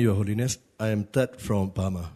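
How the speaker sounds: normal talking voice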